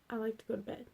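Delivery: usual speaking voice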